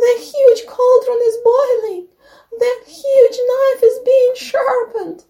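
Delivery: very high, loud bleating little goat; high-pitched wailing plea, held on one note; bleating kid. A narrator's reading. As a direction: cry out for help like a frightened baby goat. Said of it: "started bleating".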